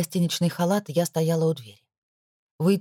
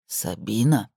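low male voice; 7 semitones lower, slower than usual. Acted. Амин зовёт.

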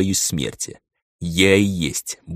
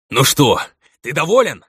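louder, higher furious challenge; angry; 7 semitones higher, 6 dB louder than usual. A narrator's reading. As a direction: spit it out in rage. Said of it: "гневно брызгая слюной". (гневно)

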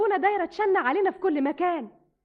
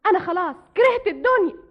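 raised, fed-up cry; louder and higher, sick of it all; despairing outburst; 4 semitones higher, 6 dB louder than usual. Acted. Despairing girl cries out. (صرخة يأس)

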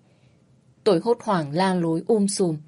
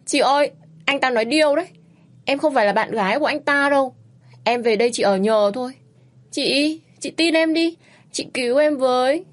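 pleading; 6 semitones higher, 3 dB louder than usual. A narrator's reading. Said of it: "lại cầu cứu".

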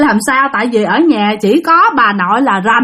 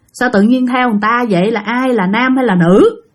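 usual speaking voice

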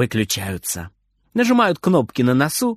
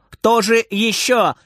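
shouting; 7 semitones higher, slower than usual. A narrator's reading. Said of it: "кричит Фридер".

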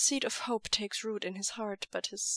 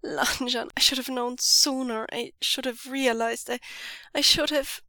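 higher, louder, building into a sob; breaking down in tears; dissolving into sobs; 4 semitones higher, 6 dB louder than usual. Acted. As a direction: crack more with every line. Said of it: "his voice dissolving into a sob".

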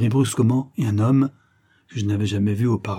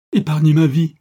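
loud, high-pitched begging; terrified moaning plea; wailing plea; 6 semitones higher, 7 dB louder than usual. A narrator's reading. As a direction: beg for your life. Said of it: "gémit-il".

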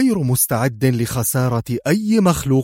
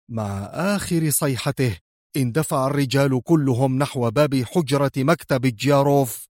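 ordinary delivery, nothing marked

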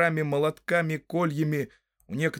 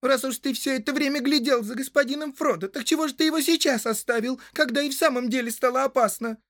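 very high, insistent woman's voice; Rosie scolding; 12 semitones higher, 4 dB louder than usual. A narrator's reading. Rosie reproaching sweetheart for leaving his master, scolding. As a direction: tell him off in a high girlish voice. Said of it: "прикрикнула Рози".

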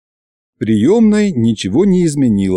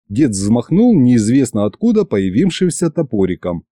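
ordinary delivery, nothing marked

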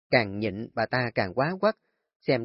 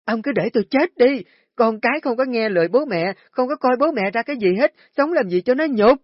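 shrill, much higher and louder hysterical wailing; wailing scream; 11 semitones higher, 8 dB louder than usual. Acted. Wife rolling on the floor wailing at husband, hysterical screaming. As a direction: throw a tantrum, screaming to the heavens. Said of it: "bà Hoạt càng hét lớn".

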